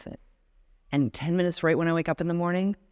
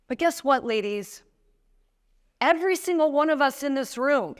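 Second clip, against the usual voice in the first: rousing rally; 7 semitones higher than usual. Acted. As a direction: lift the whole room up, full force. Rallying the room of women.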